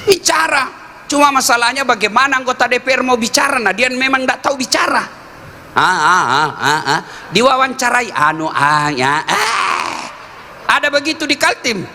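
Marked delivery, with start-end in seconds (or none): 0.1-0.7 raised, higher pitch exhorting; preaching at a rousing pitch; urging shout. Real, not acted. Preacher urging parliament members to speak. 5.7-10.0 hammed-up stammering um-ah; mock a tongue-tied lawmaker; mock halting um and ah; stammering official. Mocking stammering official interviewed.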